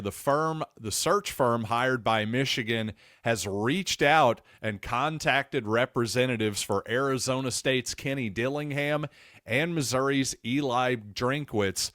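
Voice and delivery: ordinary talk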